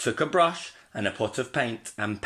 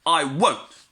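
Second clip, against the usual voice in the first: higher, louder defiant shout; angry refusal; 6 semitones higher, 5 dB louder than usual. A narrator's reading. Little Wolf refuses the Porkers, an angry shout. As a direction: refuse furiously. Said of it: "he roared".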